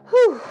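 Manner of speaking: loud high whew of effort; effort exhale. Real, not acted. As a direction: blow out the effort. Exhale at end of set.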